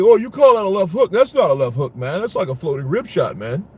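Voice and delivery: high, loud, scoffing champ voice; pompous boxer impression. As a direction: sneer at the punch like a cocky boxer. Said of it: "the first thing he said".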